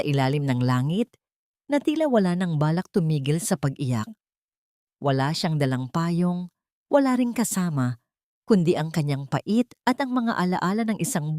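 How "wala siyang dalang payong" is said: ordinary talk, nothing marked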